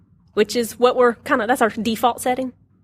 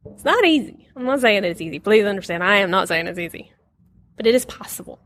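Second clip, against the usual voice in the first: normal talking voice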